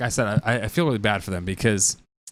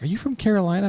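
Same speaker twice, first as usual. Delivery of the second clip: higher, dim-witted question; mock clueless question; 9 semitones higher than usual. A clueless stranger guessing the Australian friend's accent. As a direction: ask it like you have no clue. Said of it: "One person was like".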